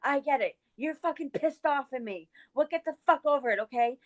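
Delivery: high-pitched, fed-up retort; retold angry outburst. Retelling blowing up at friend in the car, exasperated. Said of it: "punched the dashboard".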